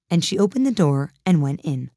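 ordinary delivery

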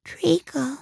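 high, breathy half-whisper; sleepy voice. A narrator's reading. Dormouse behind the cook, sleepy high whisper. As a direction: murmur it high as if half asleep. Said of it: "said a sleepy voice".

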